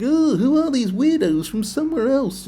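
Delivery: high, loud mocking villager voice; disdainful villagers. Voices villagers disliking newcomers.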